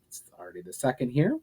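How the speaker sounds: usual speaking voice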